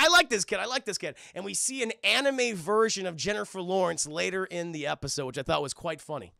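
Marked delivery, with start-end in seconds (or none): 0.0-0.6 excited burst. Real, not acted. Streamer cheering Itadori, a loud delighted burst. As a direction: blurt it out delighted. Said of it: "I was like".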